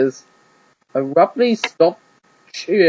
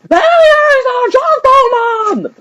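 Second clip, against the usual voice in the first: much higher, louder, slow booming announcer; stadium announcer; 7 dB louder, much slower than usual. Acted. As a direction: open the show to the crowd. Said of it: "he announced and cried".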